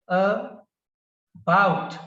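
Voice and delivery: "About" split slowly, light a, then strong BOUT; stress on second syllable. Shown as a demonstration. Teacher demonstrates the accent pattern, "About" broken into a-BOUT. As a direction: pause after the first syllable of "About", then punch BOUT. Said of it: "The stress is on the second syllable".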